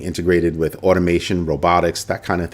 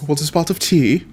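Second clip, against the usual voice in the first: lightly put-on English accent, pitched higher; 7 semitones higher than usual. Streamer showing off his cloned voice's accent, mock English accent. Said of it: "gave it an English accent".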